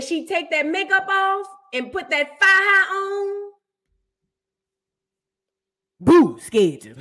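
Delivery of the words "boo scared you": mock scare. Mocking scary no-makeup face.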